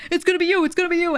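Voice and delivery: insistent chatter. Retold or imitated. Fans in chat insisting to the streamer, high sing-song. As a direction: chant it at her like an annoying fan.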